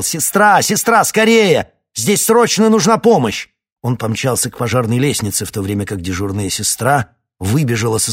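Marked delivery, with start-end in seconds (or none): 0.0-3.5 loud, high, urgent shout; shouting for help. Acted. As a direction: yell down the corridor for the nurse. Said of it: "выскочил в коридор и закричал".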